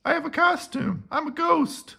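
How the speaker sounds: high, pleased announcement; delighted. A narrator's reading. Boris proud of his ghost costume, high delighted voice.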